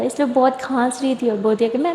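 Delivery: usual speaking voice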